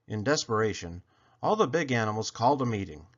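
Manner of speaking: usual speaking voice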